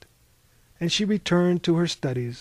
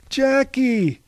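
high, loud cry of a name; anguished cry; 10 semitones higher, 7 dB louder than usual. A narrator's reading. Dragon sees the boy killed, high loud cry. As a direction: cry out in horror. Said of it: "cried the dragon".

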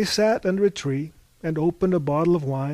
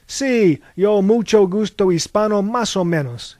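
loud, higher, boastful broken Spanish; proud; 6 semitones higher, 9 dB louder than usual. A narrator's reading. American shows off his Spanish to a local woman, proud. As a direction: show off your bad Spanish proudly. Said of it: "he said with pride".

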